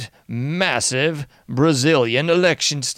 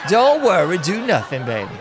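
mocking slogan; 5 semitones higher than usual. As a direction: belt the jingle, laughing. Mock slogan laugh.

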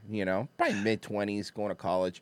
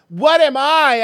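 called-out question; 13 semitones higher, 10 dB louder, slower than usual. Retold or imitated. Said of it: "He goes".

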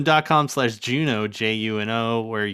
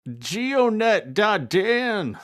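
slow, high grandpa spelling it out; old grandpa voice; 8 semitones higher, much slower than usual. Pretend. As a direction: drawl the web address like an old man. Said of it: "I love Grandpa Dan".

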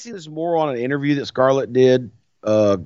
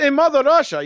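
lightly put-on Russian accent, pitched high; mock Russian accent; 13 semitones higher, 4 dB louder than usual. Co-host mimicking the too-obvious spy, mock Russian accent. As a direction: imitate a stagey Russian accent. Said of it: "When they talk like that".

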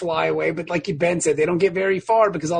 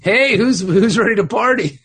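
rowdy party animal; 3 semitones higher, 6 dB louder than usual. Retold or imitated. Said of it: "And you're like".

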